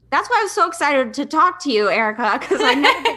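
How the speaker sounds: gushing to her host, breaking into a laugh; excited. Real, not acted. Talking to host Erica, excited and laughing. Excited to finally share story.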